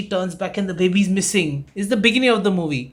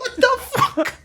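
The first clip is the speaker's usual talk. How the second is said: much higher, laughing shocked cry; startled fright. Retold or imitated. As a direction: jump out of your skin, then laugh. Roommate opens door in the dark.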